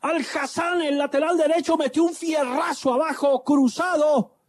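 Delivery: high, stretched goal call; breakneck goal commentary. Acted. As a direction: sing out the goal like radio commentary. Radio goal call play-by-play.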